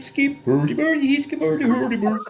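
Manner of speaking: high, silly mock Swedish Chef gibberish. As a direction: babble it like the Muppet cook. Imitating Danish Muppet cook.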